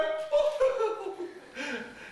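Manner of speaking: high cackling witch laugh; witch cackle. Acted. Old witch cackles at the spider's greeting, high cackle.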